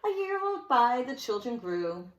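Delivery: lilting rhymed read-aloud; sing-song storybook telling; story narration. A narrator's reading. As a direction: read it to children with bounce. Reads a rhyming picture book.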